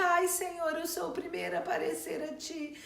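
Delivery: high, whiny fake apology, pitched well up; mock groveling plea. Preacher mocking Simei's false plea to the king, high and whiny. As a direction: ham up a false man begging the king's pardon. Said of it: "amaldiçoava o rei e de repente".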